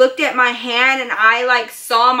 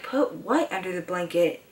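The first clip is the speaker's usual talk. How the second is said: low, hushed puzzled question; groggy confusion; 5 semitones lower, 10 dB quieter than usual. Retold or imitated. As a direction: mumble it softly, just coming to. Groggy, confused reaction on waking.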